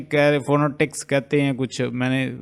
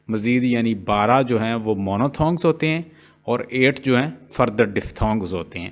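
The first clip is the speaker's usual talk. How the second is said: ordinary delivery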